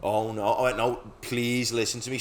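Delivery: normal talking voice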